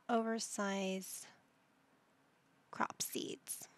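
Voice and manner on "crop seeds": whisper. Real, not acted. Muttering shopping choices.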